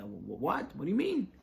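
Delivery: stammer, then a high startled "what do you mean"; shocked disbelief. Retold or imitated. Rabbi to host, stunned and rising high. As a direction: caught completely off guard. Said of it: "Rabbi Wasserman was shocked".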